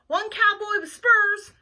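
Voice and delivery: loud, slow, high order call; waitress yelling out an order; hollering. Acted. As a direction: holler it slowly to the kitchen. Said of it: "hollered the waitress".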